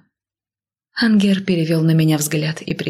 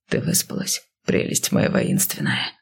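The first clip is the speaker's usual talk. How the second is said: low, hushed male voice; affectionate husband; 4 dB quieter than usual. A narrator's reading. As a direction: murmur it low and softly. Kyle greets his wife after a kiss.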